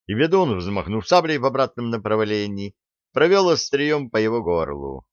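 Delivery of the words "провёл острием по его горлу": plain reading voice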